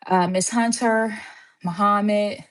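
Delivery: ordinary talk, nothing marked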